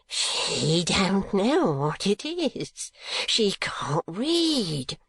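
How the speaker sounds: high, very slow frantic wail; shrieking. Acted. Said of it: "shrieked Gride".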